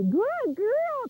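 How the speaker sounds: high, bright praise for the dog; excited praise. Shown as a demonstration. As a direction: gush over the dog like she's a star. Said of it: "Get excited".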